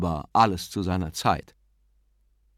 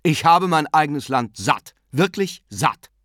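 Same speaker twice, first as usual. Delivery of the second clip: louder, heated outburst; sudden vehemence; 6 semitones higher, 6 dB louder than usual. A narrator's reading. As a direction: blurt it out hotly. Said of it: "erwiderte Gabriel plötzlich heftig".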